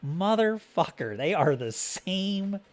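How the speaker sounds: slow-dawning mock outrage; acted disbelief. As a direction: draw it out in indignant disbelief. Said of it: "you're like".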